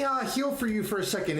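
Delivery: ordinary delivery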